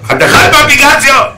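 high, loud shriek; mock hysterical shriek; shrieked punchline. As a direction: scream the punchline. Repeated punchline, shouted.

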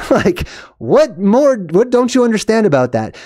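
high-pitched mock exasperation; incredulous, high imitation of an exasperated father. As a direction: ask it as the fed-up dad, pitch up. Voicing the exasperated dad.

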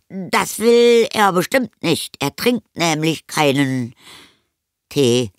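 put-on high, slow, strained boy voice; evasive boy. A narrator's reading. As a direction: fend off the invitation as an anxious child. Boy fending off mother's invitation. (ausweichende, hohe Jungenstimme)

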